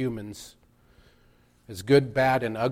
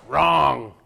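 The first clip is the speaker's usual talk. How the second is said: put-on loud, sudden "Wrong" as a mother correcting a child; scolding mom; 2 semitones higher, 9 dB louder than usual. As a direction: imitate a mom's loud correction. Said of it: "first time that your mom said".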